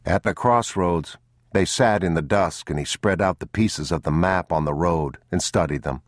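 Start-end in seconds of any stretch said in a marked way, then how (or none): none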